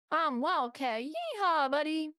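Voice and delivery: imitated country accent, lifting on the yeehaw; countryside twang. Streamer imitating a gamer's whole countryside act, country accent. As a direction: put on a hearty countryside accent. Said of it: "the whole countryside".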